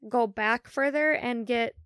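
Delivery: ordinary talk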